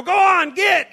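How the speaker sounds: slap-and-shout send-off; shooing shout. Pretend. Cowboy loudly shooing his horse into the desert. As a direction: yell it high and loud to send the horse off.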